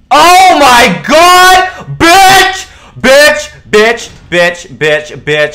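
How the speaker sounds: very high, loud screamed insults, falling away; furious screaming. Real, not acted. Streamer screaming at the duck on camera, furious screaming. Duck appears right there.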